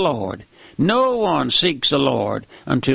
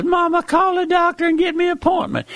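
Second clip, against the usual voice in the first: much higher, whiny sick-man voice; sick man whining; faster than usual. Acted. A sick man begs Mama for a doctor, whining high. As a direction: plead like a feverish man in bed.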